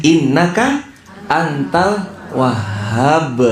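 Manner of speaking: high start, drawn-out Arabic chant; slow verse recitation. Acted. Preacher sings the verse's romantic ending to the congregation slowly. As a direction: stretch antal wahhab out slowly.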